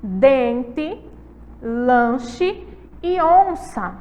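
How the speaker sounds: "Dente, lanche e onça" spoken slowly, nasal vowels clear; slow nasal word drill; slow model words. Shown as a demonstration. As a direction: stretch each word for the class. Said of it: "Repitam comigo".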